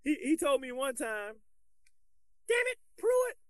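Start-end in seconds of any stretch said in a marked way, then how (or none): 2.5-3.4 very high, slow coach chewing out a player; exaggerated high-pitched coach impersonation; old coach's voice. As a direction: squeal the reprimand, drawn out. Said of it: "That's his voice".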